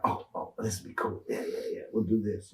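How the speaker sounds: unvoiced, hushed retelling of the plan; hushed scheming. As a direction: whisper it like a secret idea. Retold excited drug plan thought.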